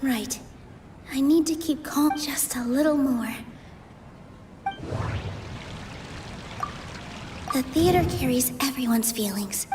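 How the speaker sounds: hushed private whisper to herself; inner whisper. Acted. Nilou telling herself to stay calm, whispered. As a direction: whisper it, steadying your nerves.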